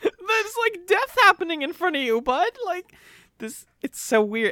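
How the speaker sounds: very high, cracking incredulous whine; mock disbelief. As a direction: squeal in mock disbelief, pitch way up. Mocking bafflement at characters.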